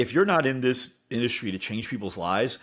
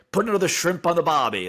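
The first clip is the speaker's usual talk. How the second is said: a hint of a hammed-up Aussie, pitched higher; mock Australian accent; 6 semitones higher, 3 dB louder than usual. Host mocking how Americans imitate Australians, light Aussie twang. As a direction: toss off the tourist cliché in a light Aussie twang. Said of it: "when we imitate an Australian".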